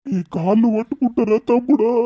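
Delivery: high, wailing plea; tearful pleading. Acted. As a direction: beg him through tears, overwhelmed with gratitude. Falls at his brother's feet. (ఏడుస్తూ వేడుకోలు)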